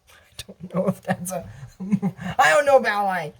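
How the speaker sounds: usual speaking voice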